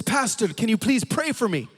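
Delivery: put-on tearful old lady. Old lady asking the pastor for prayer, mildly tearful plea. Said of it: "she is crying".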